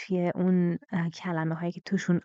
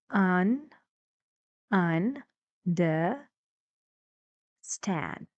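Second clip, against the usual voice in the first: "un-, un-, the-, stand" split up, with "the-" unstressed; syllable-by-syllable stress; much slower than usual. Shown as a demonstration. Teacher breaks understand down for students, "the-" completely unstressed between "un-" and "stand". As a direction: separate each syllable of "un-, the-, stand", keeping "the-" weak. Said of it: "This part is completely unstressed".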